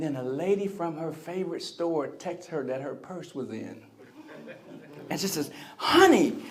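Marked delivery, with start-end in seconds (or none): 5.8-6.4 imitating a thrilled wife, high and loud; excited. Wife calls husband because her purse came in, excited. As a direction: burst out with sudden delight. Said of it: "She felt so much better when her purse came in".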